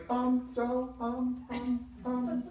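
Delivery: timid, high and soft stammer; hesitant presenter. Acted. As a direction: stall nervously in a small, high voice.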